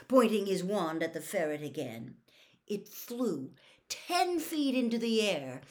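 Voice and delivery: ordinary delivery, nothing marked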